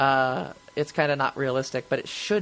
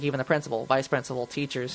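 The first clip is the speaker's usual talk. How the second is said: ordinary talk, nothing marked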